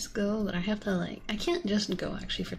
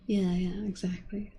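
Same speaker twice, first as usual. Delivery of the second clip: ordinary talk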